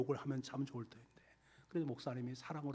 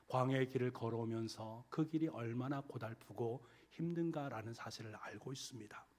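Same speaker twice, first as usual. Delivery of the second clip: ordinary delivery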